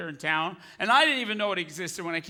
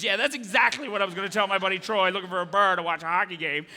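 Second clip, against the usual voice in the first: usual speaking voice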